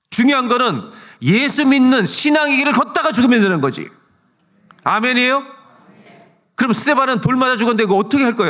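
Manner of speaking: high, loud, impassioned sermon; full-force preaching. Acted. Pastor challenges the congregation about death in faith, full-force preaching. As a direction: drive it home to the whole congregation.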